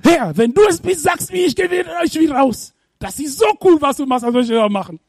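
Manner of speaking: high, eager pleading to come out; excited Peter. Acted. Peter calling to Jesus from the boat, excited. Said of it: "Und er sagt".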